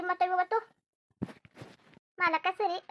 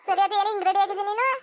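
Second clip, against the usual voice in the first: louder, laughing tease; teasing laugh; 3 semitones higher, 6 dB louder than usual. Acted. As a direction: laugh at how he's dressed up. Teasing laugh at dressed-up man.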